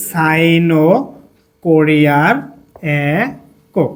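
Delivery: drawn-out words with long pauses; slow dictation. Shown as a demonstration. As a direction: dictate word by word, slightly low. Dictating while writing on board.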